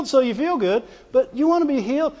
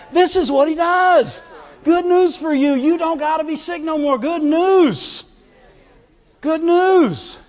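excited proclaiming; 6 semitones higher than usual. Acted.

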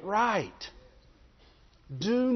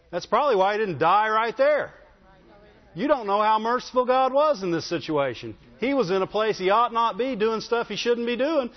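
usual speaking voice

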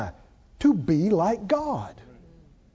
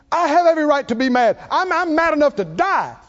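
higher, louder mock rant; Jonah's furious protest; 7 semitones higher, 6 dB louder than usual. Preacher voicing Jonah's angry protest to God, mock furious shout. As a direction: belt it out like a sulking prophet. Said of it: "you know what Jonah says".

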